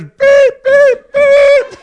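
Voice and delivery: very high, loud, fast string of bleeped curses; bleeped tirade. Retold or imitated. Dad going through the roof at his family, bleeped tirade. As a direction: blow up, beep after beep. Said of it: "And I went around and went upstairs".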